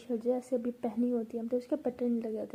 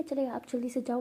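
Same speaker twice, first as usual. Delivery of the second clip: plain reading voice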